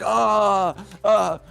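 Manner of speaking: high loud hammed-up cries; mock pained cries. Green guys punched in the dick cry out, high and loud. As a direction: cry out like everyone's being punched. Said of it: "the green guys are like".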